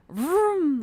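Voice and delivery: drawn-out vroom gliding up and down; imitated engine vroom. Player imitates the noise outside the window, engine vroom. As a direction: mimic a car revving past, pitched high. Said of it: "I got distracted by vroom outside".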